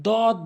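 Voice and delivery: দদ short, with a plain dental d; mispronounced দদ. Shown as a demonstration. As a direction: say দদ as Bengali "dod". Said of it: "ভুল উচ্চারণ".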